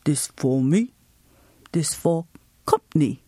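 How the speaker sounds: put-on low, accented old grandfather pouring drinks; grandpa's voice. Grandpa offering the child tea, low and accented. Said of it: "He'd say".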